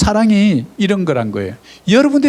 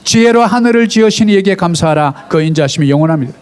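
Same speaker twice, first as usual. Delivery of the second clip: loud, steady reading of the psalm aloud; loud scripture reading; 2 semitones higher, 4 dB louder than usual. Shown as a demonstration. Preacher leads the congregation in a loud psalm reading. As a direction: read the psalm out loud with the church. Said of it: "크게 한번 같이 읽습니다".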